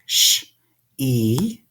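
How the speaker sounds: "Sh" then a long "E"; sounding out sheep. Shown as a demonstration. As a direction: split sheep into "Sh" and "E". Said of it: "Hear the E there".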